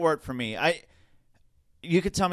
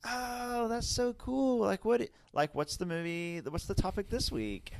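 put-on much higher, soft gushing teen girl; valley girl voice; 8 semitones higher, 5 dB quieter, slower than usual. Imitating the Starbucks teen, higher and softer. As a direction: mimic a breathless teenage barista. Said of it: "This is how she talked".

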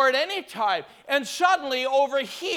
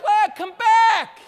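high, loud, stretched holler; mock holler after a runaway; calling after someone; 8 semitones higher, 6 dB louder, slower than usual. Preacher acting out calling a healed lady back, hollering.

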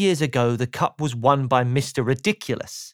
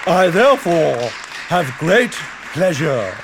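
pompous king; 5 dB louder than usual. Acted. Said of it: "announced the king".

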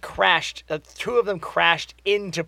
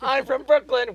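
mocking Cap; 7 semitones higher than usual. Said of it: "We know".

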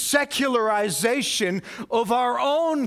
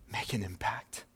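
whispered emphasis; 11 semitones lower, 12 dB quieter than usual. Acted. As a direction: whisper it so they lean in. Sermon climax dropped to whisper.

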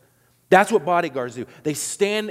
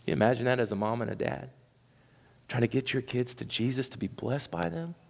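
hushed empathy; 5 semitones lower, 6 dB quieter than usual. Real, not acted. Empathetic quiet aside.

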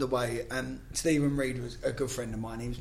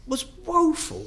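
woeful stretched slow and pitched much higher; drawn-out emphasis; slower than usual. Real, not acted. Pundit slamming England's Iceland game, woeful stretched high.

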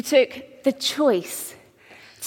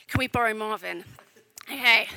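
ordinary talk, nothing marked

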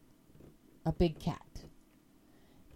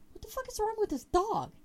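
much higher, exasperated cry of disbelief; 14 semitones higher than usual. Retold or imitated. At her dog staring at something, high exasperated disbelief. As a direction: throw the question up, baffled and fed up.

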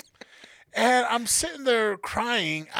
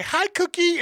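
high, friendly call to a dog; sweet greeting; 9 semitones higher than usual. Retold or imitated.

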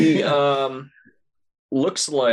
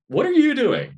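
much higher, louder disbelieving cry; incredulous shriek; 3 dB louder than usual. Acted. Calling out to viewers again.

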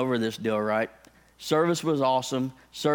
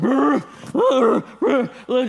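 loud growling grunts, higher and louder; grizzly bear grunts; 11 semitones higher, 6 dB louder, much slower than usual. Retold or imitated. Pastor wrestling his shirt off to find the spider, bear-like grunting. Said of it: "I sounded like a grizzly bear at a proctologist".

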